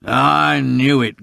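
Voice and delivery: angry growl. A narrator's reading. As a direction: growl it slowly as suspicion is confirmed. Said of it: "growled Mister Grimethorpe".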